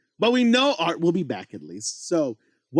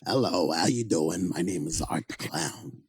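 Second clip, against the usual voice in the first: a low menacing killer clown; creepy clown voice; 8 semitones lower, 6 dB quieter than usual. Pretend. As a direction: lure them in as a sinister clown. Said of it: "What does Art the Clown sound like".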